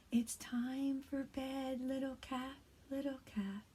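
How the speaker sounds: soft, hushed read-aloud; quiet bedtime reading. Shown as a demonstration. Teacher reads a bedtime story to kindergarteners, hushed. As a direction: lull little ones toward sleep. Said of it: "I'm gonna read this book a little quieter".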